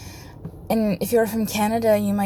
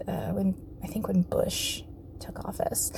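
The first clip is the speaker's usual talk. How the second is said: whisper; 7 dB quieter than usual. Real, not acted. Unvoiced aside while recalling.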